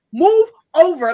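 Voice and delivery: loud, high barked order; mock telling-off. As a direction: imitate an irate officer, hammed up for laughs. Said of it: "telling, telling me off".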